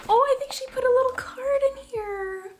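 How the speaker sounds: high, bright squeal of delight; excited squeal. Real, not acted. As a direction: squeal at the surprise card. Opening a gift box.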